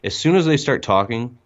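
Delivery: ordinary delivery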